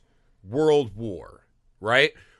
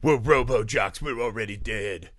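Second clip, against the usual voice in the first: lower grim mock reply; 8 semitones lower, 4 dB quieter than usual. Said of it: "and he's like".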